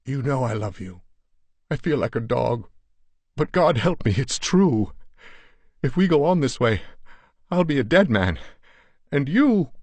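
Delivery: anguished husband rising to a desperate cry; thick with emotion. A narrator's reading. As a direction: confess it to his wife, barely holding together. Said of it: "his voice thick with laboring emotion".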